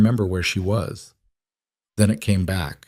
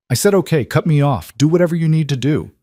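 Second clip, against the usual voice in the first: higher, louder, fast defiant snap; angry outburst; 7 semitones higher, 4 dB louder than usual. A narrator's reading. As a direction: throw it back at her. Daughter snaps back at mother.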